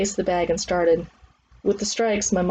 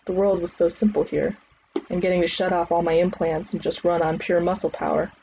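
ordinary delivery, nothing marked

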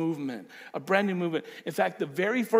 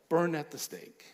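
usual speaking voice

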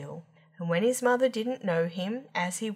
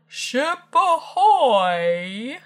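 long, loud sailor's hail; big drawn-out seaman's bellow; calling out; 6 semitones higher, 10 dB louder, much slower than usual. A narrator's reading. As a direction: hail a ship, high and stretched out. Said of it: "calling out".

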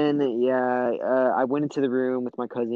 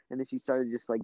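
usual speaking voice

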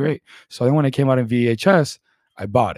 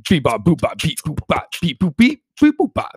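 toy robot voice; 7 semitones higher, 4 dB louder than usual. Pretend. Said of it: "hit me with it".

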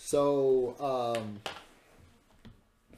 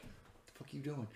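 annoyed teacher; 2 semitones higher, 14 dB quieter, faster than usual. Retold or imitated. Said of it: "that look that that teacher gives".

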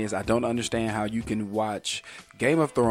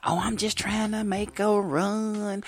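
higher, mocking sassy shopper excuse; 9 semitones higher, slower than usual. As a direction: parody a careless shopper defending herself.

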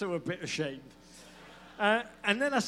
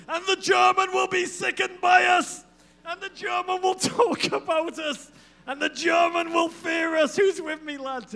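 high, loud hammed-up battle shout; Bob Bradley war cry; 11 semitones higher, 7 dB louder than usual. Coach charging alone with a knife, rallying the lads, mock high shouted battle cry. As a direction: bellow it like a lone soldier rallying the troops at Normandy. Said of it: "He'll just be like".

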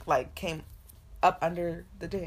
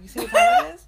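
high, loud laughing jab; loud and much higher, laughing at his expense; laughing taunt; 13 dB louder than usual. Retold or imitated. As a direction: crack up while teasing him. Taunting the man about time.

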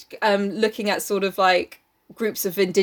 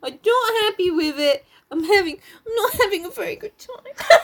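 high, whiny fake sob; mock crying; 13 semitones higher than usual. Reader bemoaning the book to co-host, mock crying. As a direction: wail it half-laughing, putting on the tears.

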